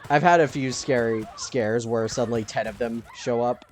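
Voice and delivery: ordinary talk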